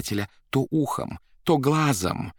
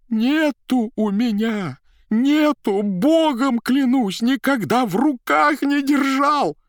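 high, loud pleading wail; desperate cry; 6 dB louder, slower than usual. Acted. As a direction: beg and swear in despair. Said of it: "отчаянно вскричал председатель".

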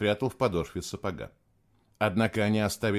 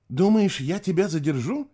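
high, louder question in disbelief; disbelieving; 8 semitones higher, 3 dB louder than usual. A narrator's reading. Kayla questioning Nicholas in shock, disbelieving. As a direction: ask it as if you can't believe your ears. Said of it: "не веря своим ушам".

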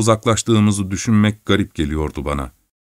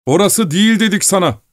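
shouting; 10 semitones higher than usual. Acted. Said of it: "diye bağırdı".

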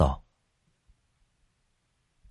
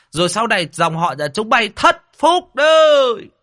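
much higher, loud shrill-edged rage; furious retort; 13 semitones higher, 5 dB louder than usual. A narrator's reading. Rival elder firing back at the other clan, enraged. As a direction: hit back in a fury. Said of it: "ông Quỳnh cũng điên máu".

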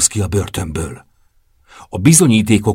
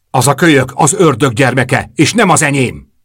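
louder and higher, spitting out each word; shouting; 5 semitones higher, 5 dB louder than usual. Acted. Bianca yelling at Mateo, denying her son, loud and high. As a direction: throw the denial at him at full voice. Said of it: "üvöltött a képembe".

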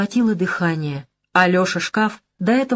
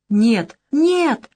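pleading; 5 semitones higher than usual. Acted. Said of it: "взмолился тот".

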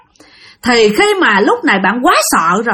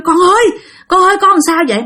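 worried calling; 7 semitones higher, faster than usual. Acted. Parents shaking scared child.

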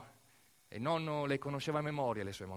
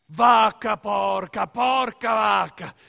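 drawn-out curse bellowed, much louder and higher; furious shout; 7 semitones higher, 13 dB louder, much slower than usual. Acted. Storyteller cursing the factory for the grandfather, shouted slowly. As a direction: roar it slowly, like an angry old farmer cursing.

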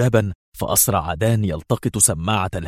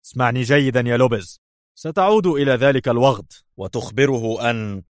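slightly higher, edgy order; tense irritation; 4 semitones higher than usual. A narrator's reading. Boss sending informant back with a message, mildly irritated. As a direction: instruct him with nervy impatience. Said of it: "وقال في عصبية".

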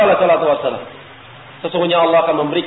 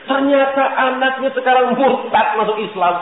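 higher, outraged father's complaint; mock the father's outrage; angry father quote; 9 semitones higher than usual.

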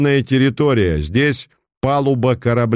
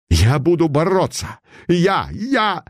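higher, wild outburst, losing his head; frantic; 7 semitones higher than usual. Acted. The cornered pretender raving at Putilin, frantic. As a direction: cry it out like a man gone mad. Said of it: "Совсем обезумел".

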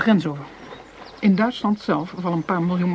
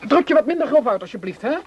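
indignant rebuke; 5 semitones higher, 3 dB louder, faster than usual. Acted. Quarrel over jealousy and rudeness.

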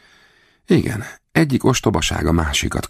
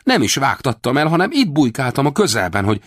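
foolish amazement; 12 semitones higher than usual. A narrator's reading. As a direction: play the simpleton. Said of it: "bárgyú álmélkodással". (bárgyú álmélkodás)

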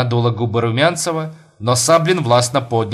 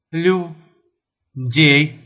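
syllable by syllable; 4 semitones higher than usual. Acted. Numb wife answers husband.

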